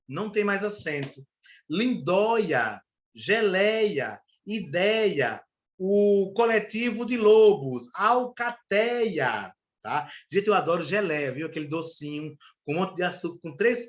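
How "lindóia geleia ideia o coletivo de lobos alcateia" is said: slow, stretched word-by-word dictation; drawn-out example words. Shown as a demonstration. Teacher listing example words for the class, drawn out. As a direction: linger on each open vowel, especially "alcateia".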